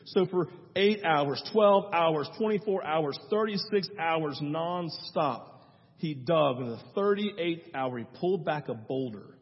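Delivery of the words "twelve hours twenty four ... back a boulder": normal talking voice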